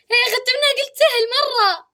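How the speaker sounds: high laughing complaint. Real, not acted. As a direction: laugh through the words. Laughing at losing.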